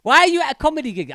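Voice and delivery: high, loud, fast indignant yell; mock outraged shout. Comic shouting at the heckler, high and fast. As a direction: blurt it out in mock disbelief. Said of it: "And I was like".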